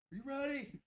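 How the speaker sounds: high, soft put-on voice for his friend; imitating buddy. Buddy asking if he's ready to time him, high soft mock voice. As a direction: pitch it way up and hush it.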